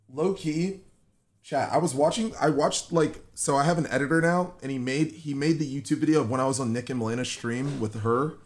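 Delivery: normal talking voice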